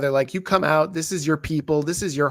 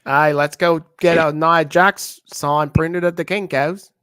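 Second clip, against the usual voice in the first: slow, louder mock Australian accent; bad Australian accent; 7 dB louder, slower than usual. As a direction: ham up a terrible Australian accent again. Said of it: "the worst Australian accent I've ever heard".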